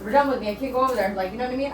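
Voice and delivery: ordinary talk, nothing marked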